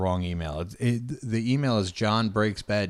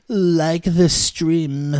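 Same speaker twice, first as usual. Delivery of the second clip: higher, louder, slow drawn-out plea; high repeat; 8 semitones higher, 9 dB louder, much slower than usual. Shown as a demonstration.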